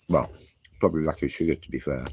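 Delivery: usual speaking voice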